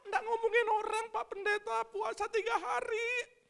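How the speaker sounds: high, whiny voice of a fasting believer; mock pious churchgoer. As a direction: play a self-righteous churchgoer protesting. Acted churchgoer voice, much higher. (suara jemaat yang sok suci, tinggi dan merengek)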